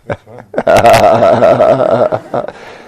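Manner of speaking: a big, loud laugh at the awkward moment; laughing while waiting for Ajahn's answer. Real, not acted. Awaiting the teacher's permission.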